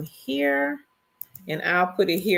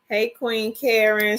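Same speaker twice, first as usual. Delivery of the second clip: ordinary delivery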